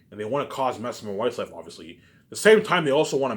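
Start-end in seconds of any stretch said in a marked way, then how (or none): 2.2-3.4 high, loud burst on same time; sudden loud, high outburst. Real, not acted. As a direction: punch it out louder and higher. Ranting about the fans.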